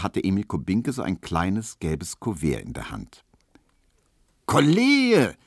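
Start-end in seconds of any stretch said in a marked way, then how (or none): 4.5-5.4 high, loud cry; shouting. A narrator's reading. Tesch calling to Kubinke about the letter, shouting. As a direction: yell out to a colleague. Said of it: "schrie Tisch".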